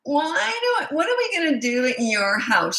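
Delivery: put-on high, nasal-free whine of a bored kid, dropping back by the end; child pestering the driver from the back seat, mock whining; whiny child. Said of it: "And she is whiny".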